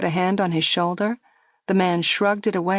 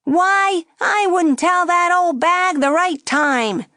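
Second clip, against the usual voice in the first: high, loud jeering puppet; raised dummy voice; 12 semitones higher, 5 dB louder, slower than usual. A narrator's reading. Dummy jeering at the girl for nearby diners. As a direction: jeer for the audience. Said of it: "the dummy said".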